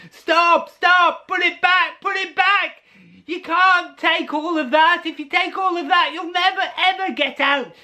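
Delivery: put-on very high, loud, urgent children's yell; frantic shout. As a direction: scream at him to stop.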